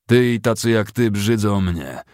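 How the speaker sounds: loud, slow spat-out disgust; contemptuous snort. A narrator's reading. Captain to a shirking soldier, contemptuous. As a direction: spit it out with contempt. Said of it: "prychnął kapitan".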